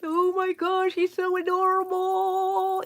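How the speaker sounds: high, loud cooing delight; gushing. Real, not acted. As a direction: gush over something cute. Gushing over finished bat.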